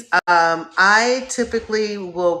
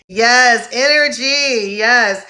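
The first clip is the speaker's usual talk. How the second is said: excited cheer; 5 semitones higher, 7 dB louder, slower than usual. Real, not acted. Cheering at viewers' energy.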